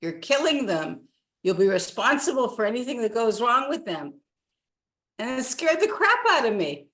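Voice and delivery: ordinary delivery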